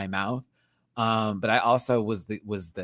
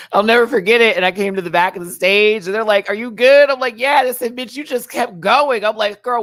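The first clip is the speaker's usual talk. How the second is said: much higher and louder, excited storytelling; excited retelling; 9 semitones higher, 8 dB louder than usual. Retold or imitated. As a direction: relive the backstage drama breathlessly. Retelling concussion backstage story.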